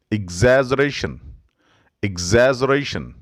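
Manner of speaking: ordinary talk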